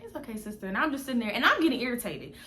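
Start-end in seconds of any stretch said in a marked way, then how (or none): 0.0-0.7 soft, much quieter reassurance; calm her gently; soothing. Retold or imitated. Friend soothing her irritated sister, soft. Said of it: "she's just looking at me like".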